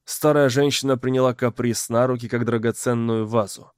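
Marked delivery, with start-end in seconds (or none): none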